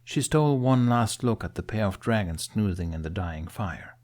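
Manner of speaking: ordinary delivery, nothing marked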